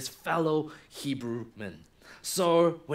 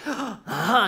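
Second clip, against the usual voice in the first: surprised gasp; 5 semitones higher, 6 dB louder than usual. Acted. Sister sees opportunity.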